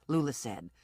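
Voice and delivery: ordinary delivery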